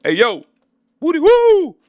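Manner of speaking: high, loud sing-song calls from a distance; old man calling out. Retold or imitated. Old dude greeting the arriving fighters, high sing-song hoot. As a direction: hoot at them like a goofy old man around the corner. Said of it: "you hear a voice saying like".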